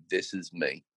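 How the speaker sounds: soft, low, quiet "This is me."; hushed, inward "This is me."; quiet declaration. Retold or imitated. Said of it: "and she went".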